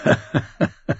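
short scoffing chuckle in character; amused mocking laugh. A narrator's reading. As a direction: laugh them off as a joke. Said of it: "hielt das Ganze noch immer für Spaß".

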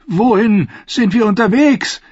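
dreaming knight questioning ghosts; Brienne asking. A narrator's reading. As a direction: ask the dead riders in fear. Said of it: "fragte Brienne".

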